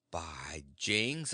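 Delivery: ordinary delivery, nothing marked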